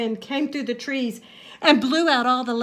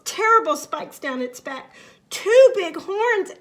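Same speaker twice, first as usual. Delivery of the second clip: plain reading voice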